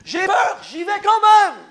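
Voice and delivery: high, loud rising declaration; defiant cry. Acted. Preacher crying out his motto to the congregation, high and loud. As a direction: proclaim it as a triumph.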